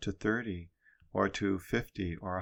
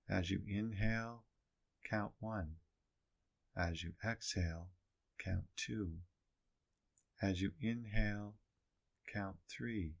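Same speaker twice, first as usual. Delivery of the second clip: soft, hushed meditation guidance; 5 dB quieter than usual. Acted. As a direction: calmly count the breaths aloud. Guides breath counting softly.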